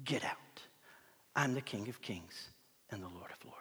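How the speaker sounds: low, hushed command; quiet authority. Acted. King of Kings to demonic power, low quiet authority. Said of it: "Takes authority over that demonic power".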